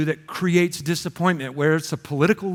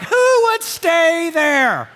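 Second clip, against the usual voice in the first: incredulous; 8 semitones higher, 3 dB louder, much slower than usual. Retold or imitated. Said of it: "you're like".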